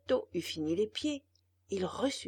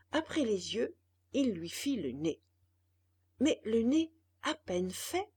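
ordinary delivery

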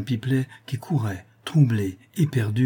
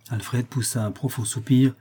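usual speaking voice

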